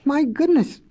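mild shocked cry. Acted. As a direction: exclaim in sudden realization. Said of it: "cried the professor".

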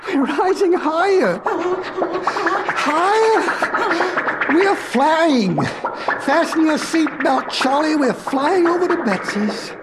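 high, thrilled shouts over an engine; exhilarated calling out. Acted. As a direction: call out as you take off. Flying car lifts off, professor thrilled.

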